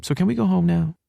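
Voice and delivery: put-on high-pitched young woman character; girl's voice. A narrator's reading. Hallie's dialogue in narrated story.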